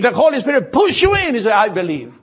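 loud, high, climbing sermon voice; fiery preaching. Acted. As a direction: preach it out at full force. Pastor preaching at full force.

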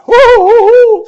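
high, loud whooping hoots; excited hooting. Real, not acted. Preacher whooping at Joseph's reveal, loud and high. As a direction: hoot with overflowing excitement.

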